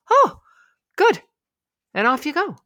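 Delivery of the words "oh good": very high, loud squeal of relief; delighted midbrain. Retold or imitated. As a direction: squeal it like a kid who got its way. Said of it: "your midbrain goes".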